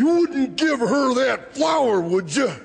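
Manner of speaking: menacing shout. Real, not acted. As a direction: warn him off with a shout. Threatening interviewer over flower.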